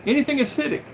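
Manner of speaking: high, keen yes; bright and eager about the fix; eager yeah. Retold or imitated. Tom eagerly telling the doctor about vinegar, high and keen. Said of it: "I go".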